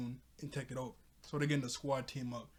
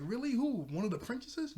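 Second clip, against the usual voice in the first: higher-pitched, hopeful questions; mock-eager surprise, voice jumping up; eager questioning; 9 semitones higher, 4 dB louder than usual. Bo asks the guard who came, eagerly.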